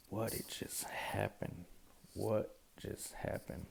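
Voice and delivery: stunned, breathless whisper, slowed; dazed hushed disbelief after walking out; shocked whisper. Retold or imitated. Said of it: "I was shocked".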